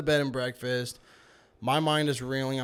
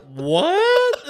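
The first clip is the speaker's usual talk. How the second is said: loud, high-pitched incredulous yell; shocked; 10 dB louder than usual. Retold or imitated. Uncle hearing niece was left behind, shocked outburst. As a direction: shoot up high in disbelief.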